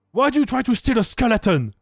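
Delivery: acted exasperated telling-off; mock scolding. Acted scolding over a skeleton.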